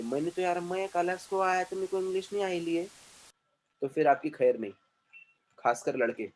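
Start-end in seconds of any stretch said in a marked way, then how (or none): 0.0-2.8 mock student excuse. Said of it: "आके बोले".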